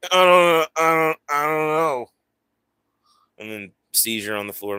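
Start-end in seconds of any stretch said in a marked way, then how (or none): 0.1-2.1 high, louder confused mumbling; mock dazed victim. Imagining being drugged by a stranger, mock dazed. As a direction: play a chloroformed guy losing it. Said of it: "Or maybe it might be".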